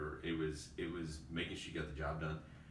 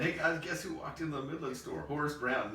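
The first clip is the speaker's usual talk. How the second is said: mock announcement; 10 semitones higher, 5 dB louder than usual. Mocking coworker's silly email.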